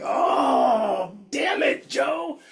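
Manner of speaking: high, cracking mock sob; retold crying. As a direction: replay the moment you broke down, voice high and crying. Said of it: "and cried".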